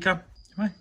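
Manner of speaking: ordinary talk, nothing marked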